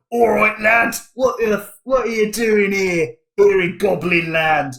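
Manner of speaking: a goblin greeting a stranger, rough and suspicious. Acted. Goblins confronting the player in Goblin Land, gruff goblin voice. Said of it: "the goblins come up to you and they're like".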